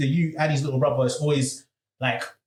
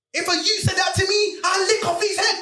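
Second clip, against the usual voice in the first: furious dad shouting; 14 semitones higher than usual. Retold or imitated. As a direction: blow up like a furious father. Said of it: "He's going off on his youth".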